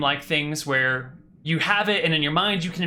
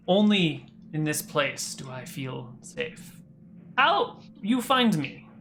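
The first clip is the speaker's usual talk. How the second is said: put-on halting accented English, phrase by phrase; prince's broken Common. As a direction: foreign accent, ominous pauses between images. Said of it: "he switches back to common".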